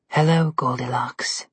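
low, slow, sinister greeting; evil queen. A narrator's reading. Evil queen greets Goldilocks from the mirror, slow and sinister. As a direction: draw it out low and menacing.

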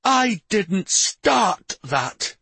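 high, slow, indignant protest; aggrieved, emphatic retort; snapping. A narrator's reading. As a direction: snap back as if unfairly accused, stretching the words. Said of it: "then snapped".

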